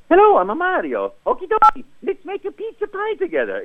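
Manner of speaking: high cheerful Italian plumber; sing-song, friendly Italian; Mario voice. Retold or imitated. At the audition, the first-ever Mario, high and sunny. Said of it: "I said".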